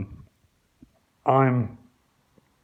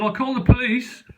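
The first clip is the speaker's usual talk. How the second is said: mock threat; 9 semitones higher, 5 dB louder than usual. His retort through the door.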